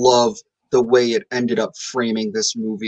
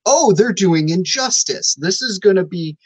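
raised, slowed voice of dawning excitement; retold fanboy thrill; excited reaction; 6 semitones higher than usual. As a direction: gush like a fan spotting a crossover. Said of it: "I was like".

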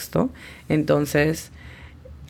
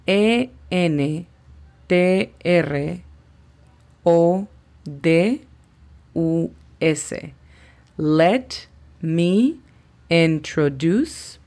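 very slow "Let me introduce", each word set apart; phonetic demonstration; much slower than usual. Shown as a demonstration. Teacher shows learners how to pronounce it, slow phonetic demonstration. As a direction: pause after "Let" and "me", stretching "introduce". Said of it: "La pronunciación fonética de esta sería".

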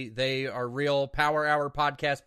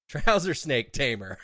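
laughing; 3 semitones higher, 5 dB louder, slower than usual. Real, not acted. Reading a funny username.

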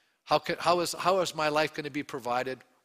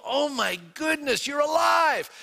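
shouted, much higher disbelieving joy; overjoyed; 10 semitones higher, 4 dB louder than usual. Retold or imitated. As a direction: shout it like you've just seen a dead friend alive. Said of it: "The disciples now are overjoyed".

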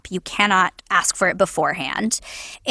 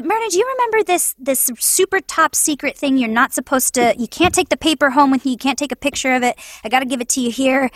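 fast, higher-pitched acted agent; hurried, secretive-business patter; rushed agent; 3 semitones higher, faster than usual. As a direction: rattle it out in an urgent rush. Agent retelling a secret request.

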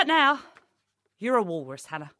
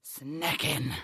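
savoured word; 8 semitones lower, 5 dB quieter than usual. Acted. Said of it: "Shall I say it again?".

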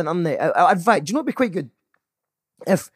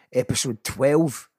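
usual speaking voice

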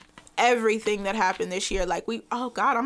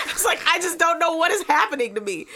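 talks through real laughter; giggling while confessing; laughing; 5 semitones higher than usual. Real, not acted. Admitting to friends she cries a lot, laughing.

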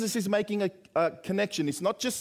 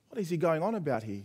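ordinary delivery

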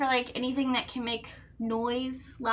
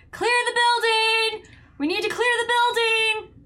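shouting alarm; 10 semitones higher, 6 dB louder than usual. Acted. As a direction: yell it out like a warning bell. Banging lantern, alerting the building.

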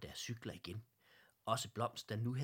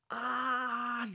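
loud high scream of fright; all cry out at once in fear; scared scream; 9 dB louder than usual. A narrator's reading. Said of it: "lød det fra alle bamserne".

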